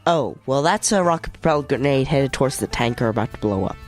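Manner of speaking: ordinary talk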